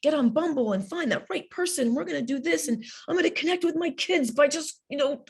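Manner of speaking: high, far-off voice of overeager hope; hammed-up cheerful determination mocking everyone; mock eager. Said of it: "We're like".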